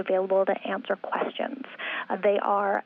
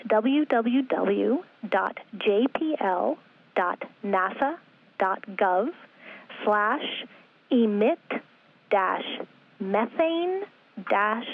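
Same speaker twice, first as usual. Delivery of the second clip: web address read slowly, piece by piece; slow dictation; slower than usual. Real, not acted. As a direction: spell it out slowly, pausing after each part. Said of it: "I'll say it slowly".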